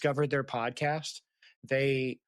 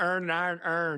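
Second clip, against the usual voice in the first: "Earn" repeated in a lightly mimicked Maryland accent; 3 semitones higher than usual. Mimicking the YouTube guy's Maryland "earn" for her co-host. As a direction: imitate the Maryland guy saying "earn". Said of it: "guy trying to say".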